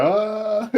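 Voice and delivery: high, stretched-out "uh"; imitated stunned "uh". Imitating the man who just sat there, stunned "uh". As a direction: mimic a man lost for words.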